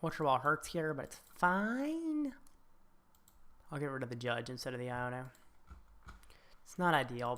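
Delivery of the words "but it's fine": sing-song reassurance. Acted. Card game going badly.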